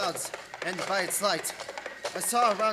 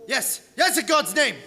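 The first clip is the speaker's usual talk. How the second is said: high, loud and pleading; a prisoner begging his host to let him leave; desperate plea; 7 semitones higher, 9 dB louder than usual. Acted. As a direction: beg loudly to be let go.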